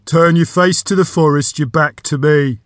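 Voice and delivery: loud, commanding gravelly creature; gravelly door voice. A narrator's reading. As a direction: give a forceful order as a gravel-voiced magic door. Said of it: "The deep, gravelly voice".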